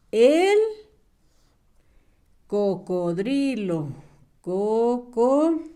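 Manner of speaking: "cocodrilo" sílaba por sílaba, muy despacio; dictado lento. Shown as a demonstration. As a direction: alarga cada sílaba para que lo escriban. Maestra dicta palabra lentamente. (dictado pausado de maestra)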